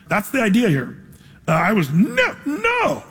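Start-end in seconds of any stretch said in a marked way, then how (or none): 1.5-3.0 mock protest leaping way up on the second no; emphatic no. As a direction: refuse twice, the last one squeaked high. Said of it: "saying it so emphatically".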